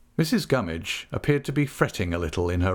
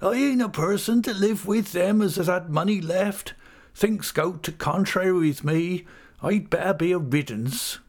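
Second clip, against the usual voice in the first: forlorn widow; 11 semitones higher than usual. A narrator's reading. Missus Gummidge refusing to cheer up, high forlorn voice. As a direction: wail it in self-pity.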